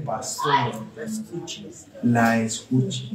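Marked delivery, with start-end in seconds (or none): none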